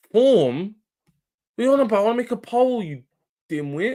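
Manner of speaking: high-pitched, indignant comeback; incredulous, high-pitched telling-off; exasperated retort. Real, not acted. Snapping back at a chatter.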